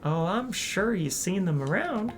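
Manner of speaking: southern hostess greeting; southern accent. Acted. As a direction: welcome the newcomer with a southern lilt. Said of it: "a southern accent in this game".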